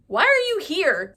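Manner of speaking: high, loud challenging question; suspicious demand. Acted. Recapper questioning Adam's presence, loud suspicious demand. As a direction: press the question with mock suspicion.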